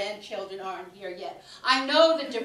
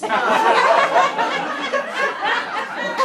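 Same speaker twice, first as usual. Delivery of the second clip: high, loud cackling laugh; a cackle that cuts the sentence off; 8 dB louder than usual. Acted. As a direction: burst into a cackle mid-sentence.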